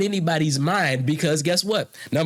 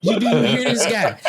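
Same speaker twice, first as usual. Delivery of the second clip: higher-pitched amused disbelief; incredulous; 5 semitones higher, 3 dB louder than usual. Real, not acted. Debater to onlookers about his opponent, incredulous. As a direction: appeal in disbelief, voice lifted. Exasperated appeal after opponent's argument.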